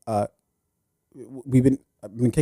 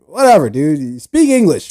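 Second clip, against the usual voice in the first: loud, higher, dismissive yank; mock ugly American; 3 semitones higher, 6 dB louder, slower than usual. Podcast host mocking Americans abroad, loud sneering yank. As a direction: sneer it like an arrogant tourist. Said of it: "a fucking American to be like".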